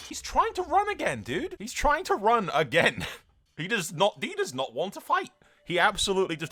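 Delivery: very high, loud incredulous outburst; high-pitched, laughing exasperation; exasperated disbelief. Real, not acted. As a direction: squeal in disbelief at a fleeing opponent. Enemy runs away again in game.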